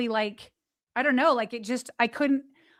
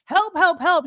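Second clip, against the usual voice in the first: loud, higher call for help; screaming for help; 4 semitones higher, 4 dB louder than usual. Retold or imitated. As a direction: yell it out for help. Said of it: "She's screaming".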